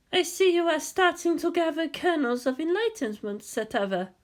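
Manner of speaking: high, calm wise woman's voice; lightly voiced wise woman. A narrator's reading. Hasya correcting Shinji, high and calm. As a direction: gently correct him from above.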